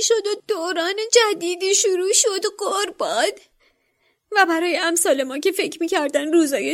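high elf voice rising into tearful pleading; the elf pours his heart out, growing to tears; confessing house-elf. A narrator's reading. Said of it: "اعتراف کرد".

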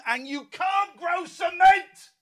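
high, slow shouted outburst. Acted. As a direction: burst out loud and high. Climax of rambling station mission.

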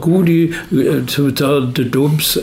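a lightly imitated English pundit, slow; Phil Neville impression. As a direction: lightly mimic an English co-commentator, drawn out. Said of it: "han sa". (lätt härmad engelsk bisittare, långsamt)

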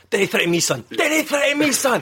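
high, rising hammed-up Scottish threat; mock Scottish accent. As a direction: threaten in a put-on Leith accent. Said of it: "I used son".

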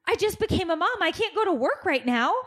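high-pitched, overdramatic girl imitation; mock distraught daughter. Daughter refusing to leave her guinea pigs, mock distraught. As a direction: plead like a melodramatic new mother.

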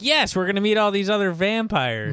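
retold excitement. Vampires invited to meet others, imitated excitement. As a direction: pipe up like thrilled invitees.

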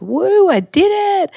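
high, loud "woo" of triumph; mock celebration. Speaker imagining relief after putting the monologue up, celebratory. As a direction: cheer it out as if you've just pulled it off.

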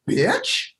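high, squeaky, offended "Bitch?"; a sudden affronted squeak of disbelief; indignant inner voice. Retold or imitated. The inner voice, jolted out of panic, reacts with a high, offended squeak. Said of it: "there's a part of you that went".

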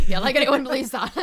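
loud, high burst of laughter through the words; helpless laughing delivery. Real, not acted. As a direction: crack up loudly as you say it. Laughing at own unconvincing claim.

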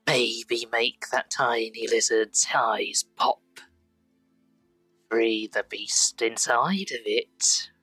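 slow grinning, smarmy whisperer. Acted. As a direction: coax them slowly toward violence. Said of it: "yellow-teethed, grinning, smarmy voice".

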